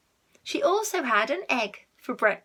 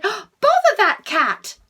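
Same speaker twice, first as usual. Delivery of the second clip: high, loud, slow cry of annoyance; startled exclamation; 10 semitones higher, 10 dB louder, slower than usual. Acted. Mrs Thomas startled by the cat, loud high exclamation. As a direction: jump and snap out the curse.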